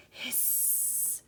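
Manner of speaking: breathy creature hiss; low hiss. A narrator's reading. Chet hiding in reeds, spooky creature hiss. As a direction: hiss like a lurking creek devil. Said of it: "Chet gave a low hiss".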